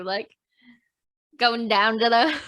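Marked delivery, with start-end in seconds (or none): 1.4-2.4 short Aussie accent demo; put-on Australian phrase. Shown as a demonstration. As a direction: show the Australian vowels. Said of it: "certain phrases that are easy to do".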